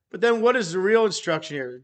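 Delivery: usual speaking voice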